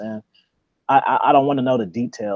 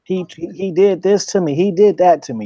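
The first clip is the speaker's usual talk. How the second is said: imitating a woman's high, whiny complaint; lightly voiced, playing the victim; mock-pitiful higher voice; 5 semitones higher, 4 dB louder than usual. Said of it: "a woman will make some shit sound so bad".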